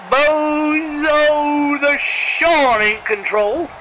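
very slow, stretched vowels; drawn-out announcer call. Real, not acted. Commentator naming who is in control, long drawn-out call. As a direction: stretch the wrestler's name right out.

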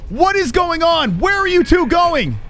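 高声慢拖，冲队友抱怨; 不敢相信队友跑了，拉高嗓门控诉; exasperated pleading. Real, not acted. Teammates abandon him on hook.

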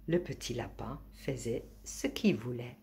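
ordinary delivery

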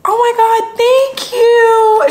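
high-pitched, gushing thank you; excited thanks. Real, not acted. Vlogger thanking a friend for her gift, excited. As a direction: squeal your thanks high.

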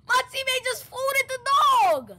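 shocked outcry. Real, not acted. Shocked at teammate's kill.

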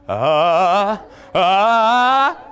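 a short, strained ah, like wobbling on a wheel; teetering unicycle ah; wobbly balancing ah. Shown as a demonstration. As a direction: call out a shaky balancing ah. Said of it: "so we go".

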